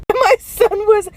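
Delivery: crying with laughter while telling the story; laughing till crying. Real, not acted. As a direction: squeeze the words out through helpless laughter. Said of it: "laughing so hard that I'm freaking crying".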